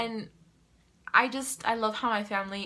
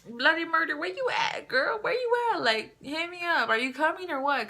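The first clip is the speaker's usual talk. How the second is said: high, sing-out calling, full of sass; playful summons; 8 semitones higher than usual. Acted. Woman calling on her late period, high mock-impatient holler. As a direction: call out impatiently in a raised, high voice.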